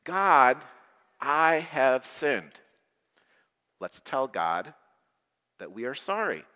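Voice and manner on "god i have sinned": slow, louder lead-along; slow group recital. Shown as a demonstration. Leading children to repeat the prayer, slow. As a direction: draw each word out so the children can follow. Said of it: "Say that with me".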